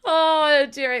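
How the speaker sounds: usual speaking voice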